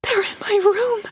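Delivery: high panicked voice; terrified. A narrator's reading. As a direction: panic rising, high-pitched. Hiding from intruders.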